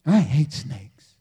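low, slow deadpan admission; deadpan confession. Real, not acted. Preacher confessing a fear to the congregation, low deadpan. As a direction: state it low and drawn out.

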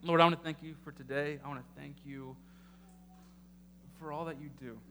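soft, earnest closing prayer. Acted. Pastor leading the church in prayer, soft and earnest. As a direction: pray quietly and humbly.

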